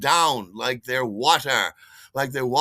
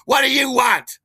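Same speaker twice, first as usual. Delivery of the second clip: loud, high confrontational shout; annoyed challenge, yelled; terribly annoyed boyfriend; 13 semitones higher, 12 dB louder than usual. Retold or imitated. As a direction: shout him down as the irritated boyfriend. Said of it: "he is terribly annoyed".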